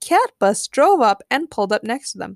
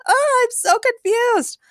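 high, loud, slow wail; confused; 5 dB louder, slower than usual. A narrator's reading. Goggles lady puzzles over metaverse, wailing confusedly. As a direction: whine it out in bewilderment.

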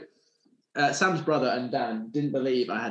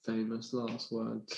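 slow ominous echo; 2 semitones lower, 9 dB quieter, much slower than usual. Shown as a demonstration. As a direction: slowly echo it with mock doom. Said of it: "Clip this when this comes out".

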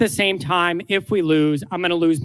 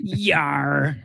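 pirate growl; 4 semitones lower than usual. Pretend. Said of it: "You sound like a pirate".

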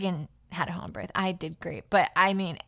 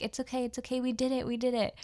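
soothing newborn; 6 semitones higher than usual. Retold or imitated. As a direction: gently comfort the crying baby. Soothing newborn on her chest.

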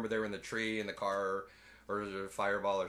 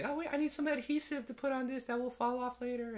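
much higher squeaky voice; mock woman voice; 14 semitones higher, 3 dB quieter than usual. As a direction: chirp it like a fussy scientist. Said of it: "and she".

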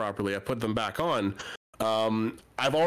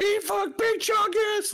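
high, slow mock shriek; hammed-up hysterical kid shout; losing his mind; 3 dB louder, much slower than usual. Imitating a kid dragged from the movie, hysterical shout. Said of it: "he was losing his mind".

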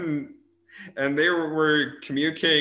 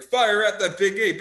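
military general; 7 semitones higher, 5 dB louder than usual. Retold or imitated. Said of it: "a military general going".

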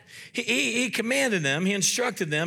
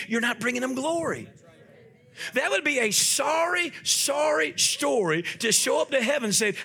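raised, emphatic preacher's exhortation; forceful preaching; 5 semitones higher than usual. Real, not acted. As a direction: preach it with rising urgency, pitch lifted.

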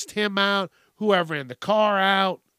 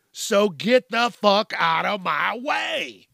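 slow, louder yell, each word hit; fed-up shouted rant; angry shout; 5 semitones higher, 4 dB louder, slower than usual. Real, not acted. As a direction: bark it out at cyclists in your way. Ranting at bicyclists.

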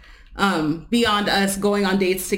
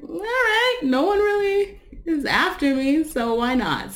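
high-pitched retold self; 9 semitones higher than usual. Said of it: "And I was like".

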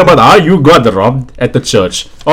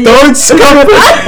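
slow, laughing plea; 11 semitones higher, 3 dB louder, much slower than usual. Real, not acted. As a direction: say it through laughter.

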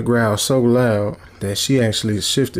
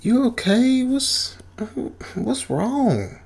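fake concern; 13 semitones higher, much slower than usual. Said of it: "Or I guess fake concern".

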